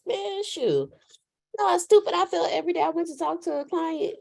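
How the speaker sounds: high, exasperated disbelief. Retold or imitated.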